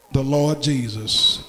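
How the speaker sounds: ordinary talk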